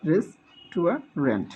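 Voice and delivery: রেস্টুরেন্ট slow, res-tu-a-rent; slow syllables. Shown as a demonstration. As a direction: spell out রেস্টুরেন্ট by sound. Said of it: "আমরা খেয়াল রাখবো".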